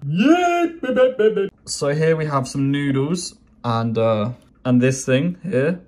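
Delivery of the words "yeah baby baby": hype cheer. Real, not acted. About to taste the noodles, he hypes himself up with a cheer. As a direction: cheer yourself on.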